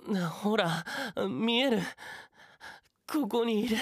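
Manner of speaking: plead, near breaking; desperate pleading. Acted.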